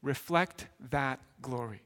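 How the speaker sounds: Paul gently urging the church, softly; gentle encouragement. Retold or imitated. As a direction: coax them gently to shine. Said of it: "And he says".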